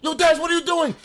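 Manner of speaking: high, loud shocked shout from classmates; retold yell. Classmates yelling at him.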